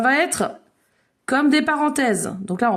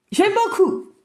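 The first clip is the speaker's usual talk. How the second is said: high-pitched, enthusiastic "J'aime beaucoup"; emphatic praise; 7 semitones higher than usual. Real, not acted. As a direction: lift the praise up high, delighted. Repeats her praise with emphasis.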